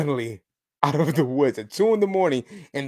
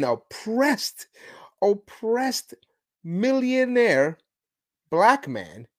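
mock-sympathetic, higher and drawn out; sarcastisch; 7 semitones higher, much slower than usual. Real, not acted. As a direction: stretch it slowly with mock pity. Sarcastic stress on oppressed millionaire.

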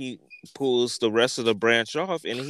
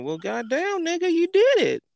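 high rising cheer; amazed squeal; 3 dB louder than usual. Retold or imitated. High squealing cheer as the branch falls.

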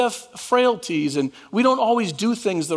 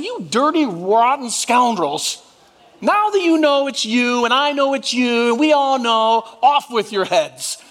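higher, hammed-up indignation, slowing on rotten scoundrels; mock angry rant; 3 semitones higher than usual. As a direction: play the furious brother he'd have been. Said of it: "I would have said".

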